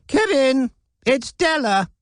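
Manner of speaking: put-on high elderly voice calling out to her husband; old woman calling. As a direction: shout through the house to him. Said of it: "called the woman".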